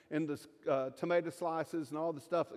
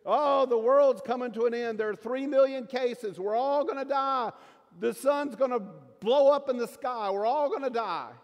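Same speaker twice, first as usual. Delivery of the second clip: loud, high doomsday alarm; hammed-up hysterical newscaster; mock news panic; 6 semitones higher, 7 dB louder than usual. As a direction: wail the end of the world, mockingly. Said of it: "That's what the news is saying".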